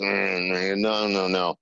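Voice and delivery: low, slow grumbling refusal; dour refusal. Retold or imitated. Meticulous Scottish director rejecting the idea, dour. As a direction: imitate a dour man dismissing an idea. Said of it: "a very dour Scot".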